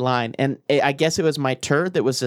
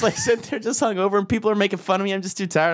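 ordinary talk, nothing marked

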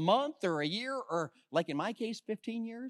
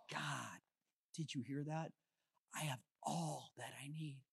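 soft, awed voice well under his usual level; hushed wonder; 5 semitones lower, 16 dB quieter than usual. Retold or imitated. Preacher reliving his quiet awe at the psalm. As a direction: murmur it as if just to God. Retells private moment with God.

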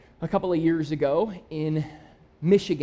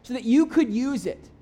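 higher and louder, building the sermon's big call; preaching at full force; 6 semitones higher, 5 dB louder than usual. Real, not acted. Pastor urging his church to volunteer, rising preaching voice. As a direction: lift it up and drive it home to the room.

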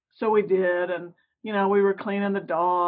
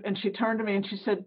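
ordinary talk

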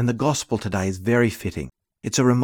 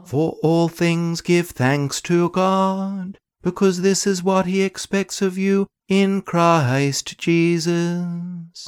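chanted verse in a resonant church; cathedral echo; 8 semitones higher, 3 dB louder, much slower than usual. Acted. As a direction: intone it slowly as in a big church. Cantor chants gospel verse.

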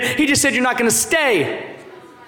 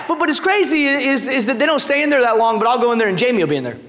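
ordinary talk, nothing marked

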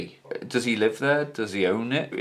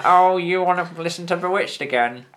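put-on higher-pitched northern English nurse accent; generic northern nurse; 6 semitones higher than usual. Northern nurse chiding a care-home resident about pop music, generic northern accent. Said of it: "my generic northern nurse accent".

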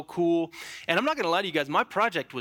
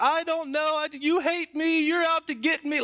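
much higher, hammed-up crying child; mock wailing kid; 12 semitones higher than usual. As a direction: imitate your sixth-grade self bawling at mom. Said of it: "And I was like".

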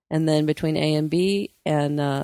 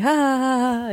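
imitated laughter; 8 semitones higher than usual. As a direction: fake a long, high "ha ha". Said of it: "we're both like".

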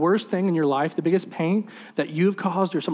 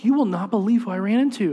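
high, excited retold exclamation; excited news; 6 semitones higher, 4 dB louder than usual. Mom telling dad whom she ran into, excited. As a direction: burst out with big news, pitch up.

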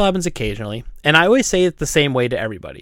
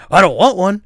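grumpy refusal; 4 semitones higher than usual. Retold or imitated. Imitating the grumpy customer's refusal.

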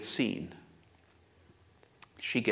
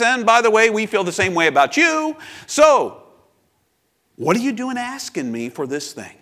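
acted pushback, climbing loud and high, then easing; defensive retort; 7 semitones higher, 6 dB louder than usual. Samaritan woman talking back to Jesus, defensive. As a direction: build from a hesitant reminder to an indignant high challenge. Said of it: "So she gets defensive".

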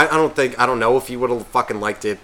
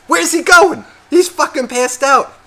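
put-on much higher and louder, slow careless drunk; drunk buddy; 6 dB louder, slower than usual. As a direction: brush it off like a loud drunk friend. Drunk friend voiced, arguing.

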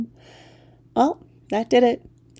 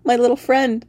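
ordinary delivery, nothing marked

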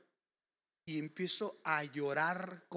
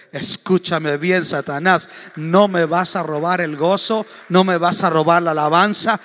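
louder, defiant challenge to the devil; defiant rebuke; 15 dB louder than usual. Acted. Preacher daring Satan, loud and defiant. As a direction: taunt the devil boldly. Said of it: "picarle la cresta al diablo".

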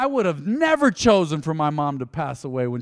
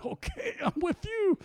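squeaky, much higher and softer okay; mock nervous agreement; 3 dB quieter than usual. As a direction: agree meekly while half-scared. Nervous agreement to the weight hog.

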